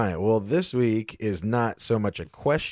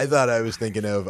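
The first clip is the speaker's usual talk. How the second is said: ordinary talk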